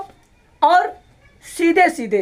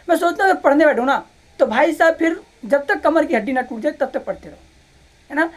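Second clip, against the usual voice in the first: normal talking voice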